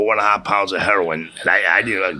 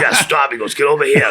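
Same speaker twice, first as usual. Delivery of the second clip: louder, higher mock command; retold police order; 5 semitones higher, 5 dB louder than usual. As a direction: imitate a cop stopping someone. Retold police stop and search.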